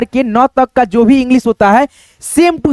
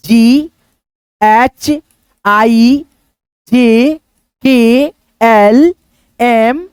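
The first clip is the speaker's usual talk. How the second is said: each letter drawn out very slowly while writing; slow letter recital; much slower than usual. Shown as a demonstration. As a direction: spell out जीएचआईजेकेएलएम one letter at a time, very slowly. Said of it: "यहाँ पे लिख देते हैं". (बहुत धीरे, एक-एक अक्षर खींचकर)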